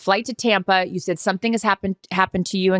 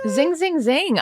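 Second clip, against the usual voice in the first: excited; 8 semitones higher, much slower than usual. Real, not acted. Echoing cold brew zing excitedly.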